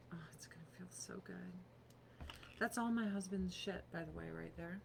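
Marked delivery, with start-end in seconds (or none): 0.1-1.5 fast breathy whisper to herself. Real, not acted. Putting cream on her face, quick whisper. Applying eye cream on stream.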